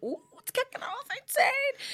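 high, loud, drawn-out glee; excited exclamation. Retold or imitated. Woman reacting to a fight outside, excited exclamation. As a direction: squeal it like delighted nosy news.

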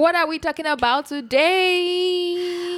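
playful topic announcement; sing-song intro. Pretend. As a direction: stretch the last word out high and long.

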